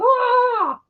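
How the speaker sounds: high hammed-up scream; mock scream. Mocking the stupid characters, a hammed-up high scream. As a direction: shriek like a silly horror victim. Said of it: "these fucking stupid people going".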